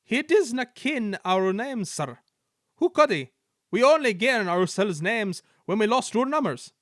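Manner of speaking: Scottish twins scoffing; Scottish twins telling the Controller in Scottish accent. A narrator's reading.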